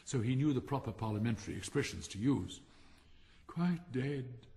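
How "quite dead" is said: plain reading voice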